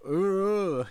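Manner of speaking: loud, rising-falling "ugh"; high, drawn-out groan of mock revulsion; acted shudder groan. Reacting to scenes in that movie, high exaggerated groan. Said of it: "I'm just like".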